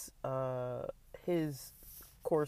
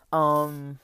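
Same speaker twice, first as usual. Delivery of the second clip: swallowing between words; just after a gulp of water; while sipping a drink; 7 dB louder than usual. Real, not acted. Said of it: "Sorry, I gotta drink this water".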